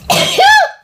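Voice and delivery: sudden loud sneeze; sneezing. Real, not acted. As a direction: let a real sneeze burst out. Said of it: "Je crois que je vais éternuer". (éternuement soudain et bruyant)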